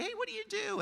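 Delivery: pitched well up, bright and casual; high friendly greeting. Retold or imitated. Neighbor to neighbor, a high casual hello. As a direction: hail the neighbor lightly.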